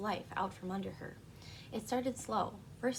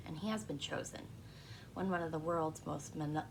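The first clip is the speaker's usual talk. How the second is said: ordinary talk, nothing marked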